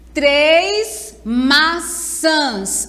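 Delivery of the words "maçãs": "maçãs" split slowly into ma-çãs with a gap; syllable by syllable. Shown as a demonstration. Teacher shows pupils the split, "maçãs" syllable by syllable. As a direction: say "maçãs" as two separate, drawn-out syllables. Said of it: "Olha, já está separado".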